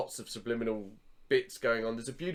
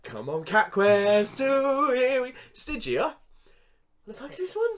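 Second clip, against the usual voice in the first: excited; 11 semitones higher, 5 dB louder, slower than usual. Retold or imitated. Excited game start, then confused.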